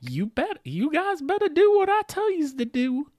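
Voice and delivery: high, bossy mock order; hammed-up producer laying down the law; Natalie. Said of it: "She's gonna be like".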